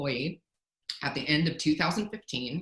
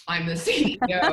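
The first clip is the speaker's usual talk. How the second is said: louder, laughing through a mock boast; a giggly parody of a boss; 2 semitones higher, 6 dB louder than usual. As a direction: laugh while sending up a self-important CEO. Mocking a bossy attitude.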